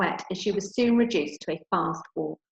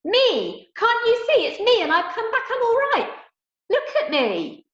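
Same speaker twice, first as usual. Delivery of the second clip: shouted again; 6 dB louder than usual. Acted. Girl begs her family to see her, shouting. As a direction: scream to be heard. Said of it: "she shouted again".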